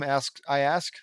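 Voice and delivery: plain reading voice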